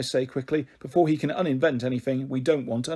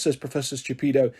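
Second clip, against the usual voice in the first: plain reading voice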